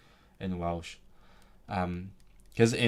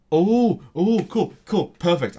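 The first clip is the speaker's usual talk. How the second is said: much higher and louder, oh cool perfect; thrilled; 8 semitones higher, 10 dB louder, slower than usual. Real, not acted. Learner hears the perfect word, bursting out high and loud. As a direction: burst out with delight at the answer. Gets the word she wanted.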